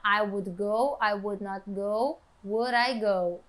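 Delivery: flat, low reading of "I would go", "I would not go", "Would I go"; monotone, no intonation. Shown as a demonstration. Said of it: "Você não pode falar". (monótono, grave, sem entonação em "Would I go")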